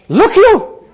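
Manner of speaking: high, loud Welsh cry of wonder; Welsh exclamation. Retold or imitated. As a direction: call it out like an amazed Welshman. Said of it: "In Welsh".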